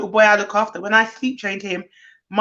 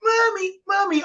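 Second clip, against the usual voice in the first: crying for mommy; 9 semitones higher than usual. Retold or imitated. Imitating the baby calling for his mum, high mock crying. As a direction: whine for mommy like a toddler. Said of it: "what his face is like".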